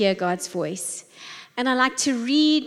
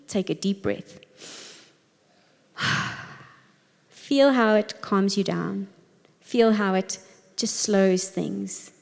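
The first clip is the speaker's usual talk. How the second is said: calm guidance; 2 semitones lower than usual. Acted. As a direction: ease them into calm. Guides a breath.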